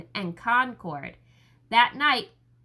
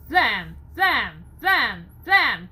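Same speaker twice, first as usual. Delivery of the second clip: loud punchy drum rhythm; drumbeat imitation; 6 dB louder, much slower than usual. A narrator's reading. Narrator mimics the alarm drums, loud and punchy. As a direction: hammer out the beats like a drum.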